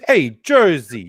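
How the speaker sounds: slow mock Jersey accent; hammed-up Jersey greeting. Co-host plays at Jersey Shore, mock Jersey accent. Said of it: "I instantly thought of Jersey Shore".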